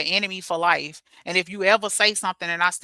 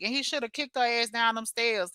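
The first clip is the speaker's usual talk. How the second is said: normal talking voice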